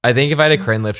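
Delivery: usual speaking voice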